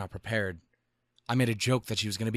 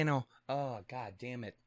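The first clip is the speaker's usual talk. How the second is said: ordinary talk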